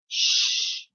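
soft, voiceless shush; long quiet shh. A narrator's reading. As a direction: hush someone secretly. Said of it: "shushed Ned".

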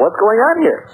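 retold demand, pitched well up. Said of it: "I demanded".

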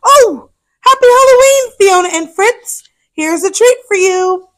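mother greeting the hippos, high and cheery; cheerful mother. Acted. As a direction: welcome the kids sweetly. Mama Armadillo greeting.

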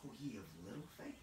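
tender, lightly voiced Jesus; gentle Jesus. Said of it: "Jesus looks at him and says".

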